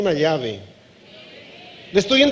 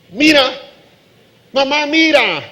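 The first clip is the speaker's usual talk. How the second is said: put-on tattling child; 6 semitones higher, 5 dB louder than usual. Tattletale child calls mother.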